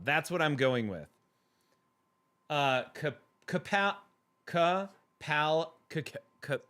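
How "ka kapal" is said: ordinary delivery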